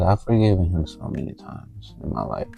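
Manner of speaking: ordinary talk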